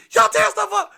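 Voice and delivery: high, loud scolding outburst; yelling grandpa. Retold or imitated. Grandpa scolding the grandkids, high angry yell. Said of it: "I'm yelling at y'all".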